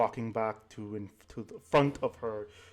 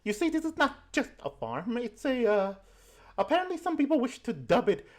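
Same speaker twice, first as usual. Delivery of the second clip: put-on old granny voice; 11 semitones higher than usual. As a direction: coo like a doting grandma pinching cheeks. Granny explains bean currency.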